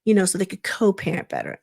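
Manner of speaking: ordinary talk, nothing marked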